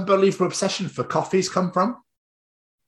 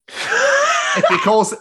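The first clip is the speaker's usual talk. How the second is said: high, slow, laugh-broken start; breaking into laughter; 4 dB louder, much slower than usual. Real, not acted. Reading the journal list to the co-host, cracking up with laughter. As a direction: lose it mid-line.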